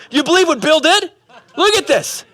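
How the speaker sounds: high-pitched, hammed-up grievance; indignant complaining. Offended man showing his family the evidence, mock indignant complaint. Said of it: "he begins to complain about it".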